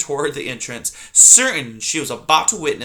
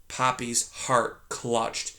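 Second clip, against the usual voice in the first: plain reading voice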